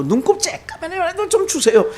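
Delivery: pleading high in Chungcheong dialect; 충청도 사투리로 애원하듯. Retold or imitated. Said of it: "우리 충청도 말로".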